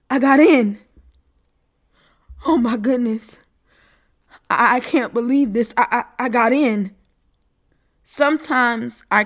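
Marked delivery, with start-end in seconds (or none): 0.1-7.0 gasping, breathless joy; overwhelmed with good news; excited disbelief. Acted. Acceptance joy.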